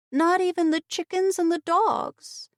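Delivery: put-on high child's voice; little girl. A narrator's reading. Child telling her aunt the house was empty, high child voice. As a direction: speak as a young girl.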